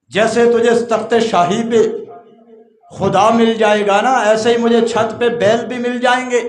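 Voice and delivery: a high, loud pointed reply; loud retort. Acted. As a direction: hurl the lesson back at the king. Man's retort preached loudly. (بلند آواز میں چبھتا جواب)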